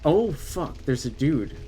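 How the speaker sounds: usual speaking voice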